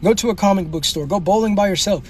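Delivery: ordinary talk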